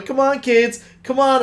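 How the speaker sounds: high, singled-out mock mom coaxing; parental urging. Imitating the wife filming her kids, urging them on. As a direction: imitate a mother egging the kids on.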